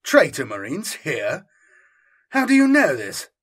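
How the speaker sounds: shocked disbelief. Acted. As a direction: blurt it out in alarm. Colonel shocked by news.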